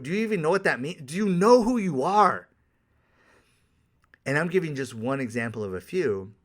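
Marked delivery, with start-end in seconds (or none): 0.0-2.5 incredulous challenge. Retold or imitated. Challenging a woman who claims emotional intelligence, mock incredulous. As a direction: push it louder and higher into disbelief. Challenging a date's self-knowledge.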